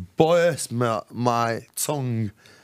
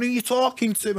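much higher, mock-aggressive challenge; bouncer squaring up; 10 semitones higher than usual. Bouncer confronting the rude drunk in Blackpool, hammed-up aggressive. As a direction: square up to him. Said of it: "And he's gone".

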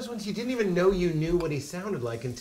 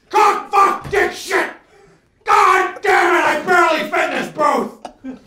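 high, very loud cursing outburst; Tony Robbins; 11 dB louder, slower than usual. Acted. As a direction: explode at a botched take, hammed up. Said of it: "He's, like, filthy".

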